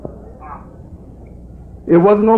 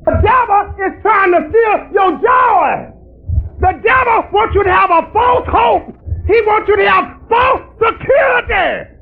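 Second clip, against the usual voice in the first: preaching at full force; 9 semitones higher than usual. Real, not acted. Preacher warning the congregation about the devil, in a high crying shout. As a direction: cry it out like a fiery preacher.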